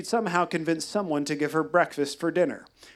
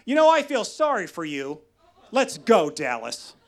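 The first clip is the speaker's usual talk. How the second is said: lashing back; 4 semitones higher, 4 dB louder than usual. Retold or imitated. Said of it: "So she lashed back".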